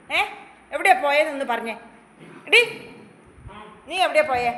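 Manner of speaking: mock scolding. Owner pretend-scolding the pet to make it laugh. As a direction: grill the pet in fake anger. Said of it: "ചോദിക്കുന്നതിന് ഉത്തരം കിങ്ങിണി പറയാ".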